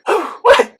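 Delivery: a high startled cry; shocked what. Retold or imitated. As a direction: yelp it in sudden alarm. Realizing the needle stick.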